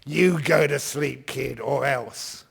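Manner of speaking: put-on loud, slow, menacing order to a child; angry parent threat. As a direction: growl it at the kid like a bully parent. Parent threatens sleepless kid.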